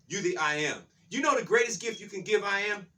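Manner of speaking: normal talking voice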